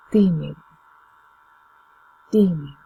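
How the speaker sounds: Tímido with the first syllable TÍ hit hardest; accent on TÍ in Tímido; stress on TÍ. Shown as a demonstration. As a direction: punch TÍ in Tímido, then let mido fall. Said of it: "put the stress where the accent is".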